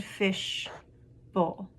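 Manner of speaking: "fish", pause, "bowl"; syllable by syllable. Shown as a demonstration. Teacher showing two syllables, broken apart. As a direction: say "fish" and "bowl" as separate parts. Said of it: "those all had two syllables".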